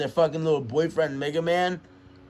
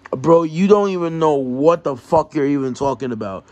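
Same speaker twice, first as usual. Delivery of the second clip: normal talking voice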